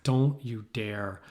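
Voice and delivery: very slow, measured warning; retold angry father. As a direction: warn word by word as a mock stern dad. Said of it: "getting so mad at me".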